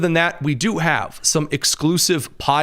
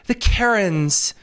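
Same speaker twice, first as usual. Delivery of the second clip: higher, mocking Karen impression; 6 semitones higher than usual. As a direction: sneer it like a caring Karen, pitched up. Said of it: "accents to mock some of the soy beta guys".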